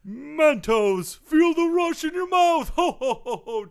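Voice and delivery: high, laughing Santa sponsor plug; ho-ho-ho through a candy slogan; jolly Santa pitchman. Pretend. Santa plugging candy to sports listeners, jolly mock Santa.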